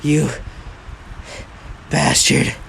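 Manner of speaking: acted worn-out insult, broken by a long pause; mock exhausted curse. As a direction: get it out in two spent pieces. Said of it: "Shinso is so tired".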